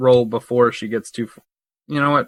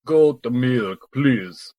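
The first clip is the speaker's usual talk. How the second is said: put-on shaky-quality Russian accent, drawn out at the end; amateur Russian character accent; much slower than usual. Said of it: "Your Russian accent is way shittier".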